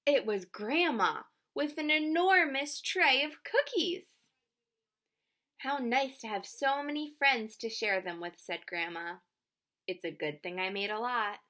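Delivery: lively picture-book reading with voiced characters; reading to children. A narrator's reading. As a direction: read it out warmly, playing each child.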